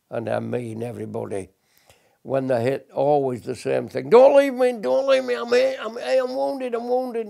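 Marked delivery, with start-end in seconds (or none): 4.1-7.3 high, pleading cry of a hit soldier; wounded soldier's cry. Retold or imitated. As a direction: cry out like a wounded man begging not to be left. Veteran voicing wounded comrades' cries.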